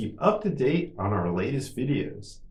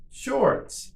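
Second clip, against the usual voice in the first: much higher, shorter take; quicker model; 14 semitones higher than usual. Shown as a demonstration. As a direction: say it quickly on a raised pitch. Teacher models word for repetition.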